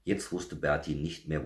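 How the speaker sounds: plain reading voice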